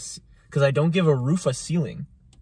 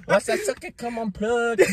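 much higher-pitched mock rap voice; high, hammed-up lyric impression; imitating rapper's pronunciation; 9 semitones higher than usual. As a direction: mimic the rapper's odd pronunciation, laughing. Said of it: "Do you hear how he's pronouncing things".

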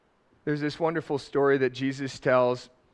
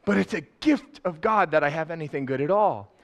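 ordinary delivery

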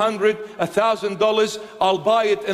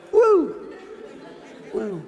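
mock singer whoop; 3 semitones higher than usual. Preacher imitating a pop singer hyping fans, laughing whoop. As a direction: whoop like a pop star working the crowd. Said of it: "because this guy went".

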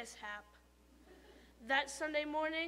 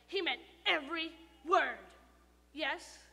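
ordinary delivery, nothing marked

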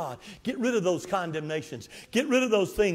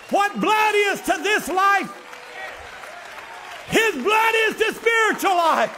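full-force preaching; 9 semitones higher than usual. Acted.

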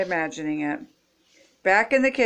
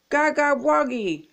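put-on baby holler; 6 semitones higher than usual. A narrator's reading. Little Pollywoggly cheers Froggy, hollering baby talk. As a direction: cheer like a babbling tot. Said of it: "hollered Pollywoggly".

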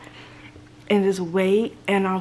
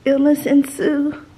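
mock dramatic; 6 semitones higher, 12 dB louder, slower than usual. Real, not acted. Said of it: "but I'm sick".

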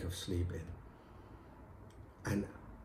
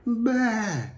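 higher, put-on bleat; goat bleat; 11 semitones higher, 6 dB louder than usual. Wife bleating back at angry husband. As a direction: answer only with a bleat. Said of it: "And she said".

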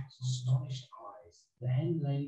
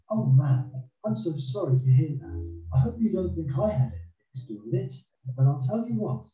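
put-on high, insistent bargaining boy; Jack, coaxing; 5 semitones higher, 4 dB louder than usual. Jack bargains with the wife for breakfast, coaxing. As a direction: talk your way in as an eager boy.

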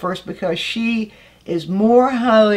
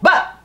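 But hit hard and much higher; emphasised but; 10 semitones higher, 4 dB louder than usual. Shown as a demonstration. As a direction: punch the word But. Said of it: "the but was an emphasis".

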